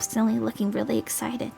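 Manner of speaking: plain reading voice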